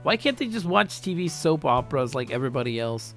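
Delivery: plain reading voice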